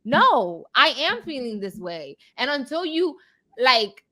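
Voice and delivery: usual speaking voice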